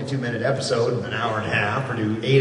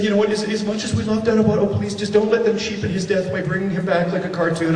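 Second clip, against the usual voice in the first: lightly voiced higher, pleading fan; 6 semitones higher than usual. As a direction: imitate a fan begging on a beloved hero's behalf. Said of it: "and they're going, "Please".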